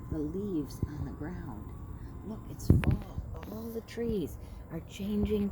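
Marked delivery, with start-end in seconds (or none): none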